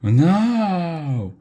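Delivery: very high, long drawn-out wail. A narrator's reading. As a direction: stretch NO into a despairing wail. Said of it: "wobbled Mordred".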